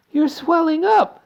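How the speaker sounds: high slow loud scream. A narrator's reading. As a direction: scream in horror. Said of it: "screamed Missus Beauregard again".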